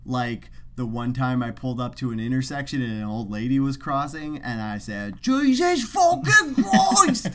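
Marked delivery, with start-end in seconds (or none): 5.2-7.3 high, loud, drawn-out British outburst; mock British accent. Telling podcast co-host about swearing in the car, mock British accent. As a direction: bellow it in an over-the-top British accent. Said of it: "Since I did it in an accent".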